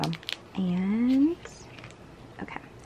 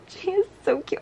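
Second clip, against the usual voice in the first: fangirl squeal; 10 semitones higher than usual. Real, not acted. Squealing over photo card.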